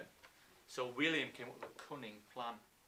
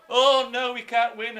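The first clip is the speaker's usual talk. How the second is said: loud, high, hammed-up wail of defeat; mock despair; 8 semitones higher, 15 dB louder than usual. Teacher acts Normans faking another retreat, loud mock despair. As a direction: cry it out as a fake surrender. Acting out the second fake retreat.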